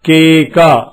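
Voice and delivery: low, careful కేక; teacher modelling కేక; model reading. Shown as a demonstration. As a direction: say it clearly for the class. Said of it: "మళ్ళీ చెప్పండి".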